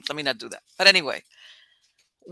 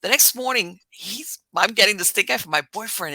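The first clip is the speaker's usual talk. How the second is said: ordinary talk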